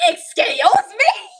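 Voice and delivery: high, loud, drawn-out outrage; indignant. Acted. Emma answering the children's father, loud high indignation.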